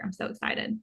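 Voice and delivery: whispered, thrilled aside; soft whispered excitement; excited whisper. Real, not acted. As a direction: breathe it like a happy secret. Whispered aside before handing over.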